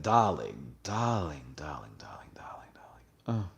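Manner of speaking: playful British "darling", fading to a whisper; mock-British swoon, dropping to a hush; British accent. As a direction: purr it in a light British accent, sinking away. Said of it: "a British accent".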